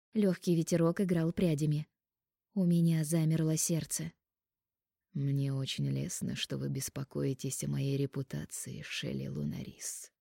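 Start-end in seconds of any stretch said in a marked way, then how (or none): 5.1-10.1 slow, low, soft drawl; drawn-out. Acted. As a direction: stretch it out slowly, lower. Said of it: "протянул он медленно".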